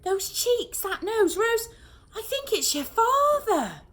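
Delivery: high, loud, slow awestruck recognition; overwhelmed with emotion. A narrator's reading. Mum telling Rose it's her father, high and moved. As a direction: lose your breath over it. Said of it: "almost overwhelmed with emotion".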